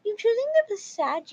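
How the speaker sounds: high-pitched mock mom voice; high, put-on accusing tone; entitled mom. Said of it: "Titled Mom".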